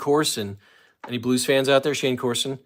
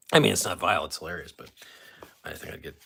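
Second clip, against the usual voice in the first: normal talking voice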